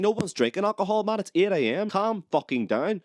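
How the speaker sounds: high-pitched, exasperated mock protest; worked-up, incredulous comeback; exasperated retort. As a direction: talk back to the tannoy in high-pitched disbelief. Acted exasperated retort to the announcement.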